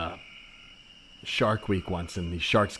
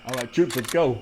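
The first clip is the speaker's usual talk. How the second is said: louder, higher, commanding the troops; rallying call; 3 semitones higher, 5 dB louder than usual. Acted. The soldier rallying the troops into the woods, loud. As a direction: rouse them like a sergeant.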